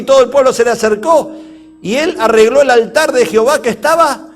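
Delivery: loud preacher's declamation; forceful scripture reading. A narrator's reading. Preacher telling how the people drew near, forceful declamation. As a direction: read it out at full volume.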